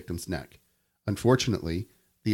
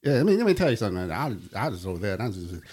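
Boomhauer impression; faster than usual. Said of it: "kinda like Boomhauer".

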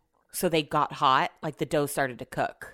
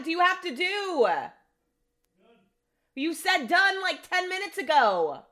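high, loud call to another room; real exasperated call upstairs; 12 semitones higher, 4 dB louder, much slower than usual. Real, not acted. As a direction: call up to him, fed up. Calling out to husband upstairs.

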